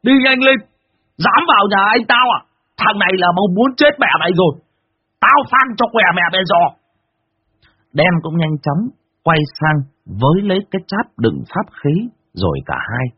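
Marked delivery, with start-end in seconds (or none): 0.0-6.6 high, loud furious yell; yelling threats. Acted. Phú Tý shouting threats in the yard, high and loud. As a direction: roar threats at the intruder. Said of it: "đứng giữa sân mà quát".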